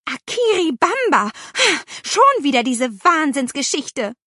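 high, loud indignant echo; exasperated outburst. A narrator's reading. Aunt fed up with the crazy story, exasperated. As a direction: throw the word back in outrage.

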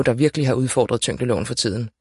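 plain reading voice

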